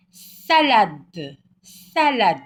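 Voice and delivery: Salade said loud and high; loud model word. Shown as a demonstration. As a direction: call out Salade so every child hears. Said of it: "Ici, on a salade".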